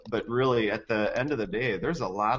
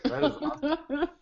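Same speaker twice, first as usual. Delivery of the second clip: laughing. Real, not acted. Laughing reaction.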